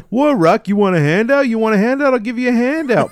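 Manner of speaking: high, loud, mocking taunt; hammed-up shouted jeer; Paulie impression. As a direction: imitate a belligerent brother-in-law, yelling high. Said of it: "He, he's like".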